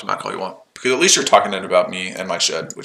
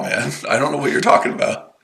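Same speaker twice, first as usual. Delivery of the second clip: ordinary delivery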